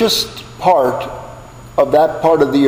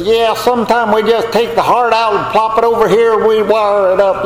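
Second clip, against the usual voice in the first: light imitation of a southern Mississippi doctor, higher and casual; mock the heart doctor's southern Mississippi ease; southern Mississippi drawl; 7 semitones higher than usual. To the congregation, lightly mimicking the southern Mississippi doctor's casual voice. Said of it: "Pickett's from southern Mississippi".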